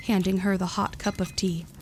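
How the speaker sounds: ordinary delivery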